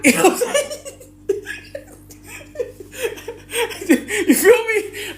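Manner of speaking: high squealing laugh through the words. Real, not acted. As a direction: crack up mid-question. Laughing at own story.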